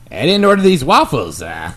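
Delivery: lightly put-on deadpan delivery; Norm Macdonald impression. Host tries a comedian's voice for friends, lightly put on. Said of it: "Can you do Norm Macdonald?".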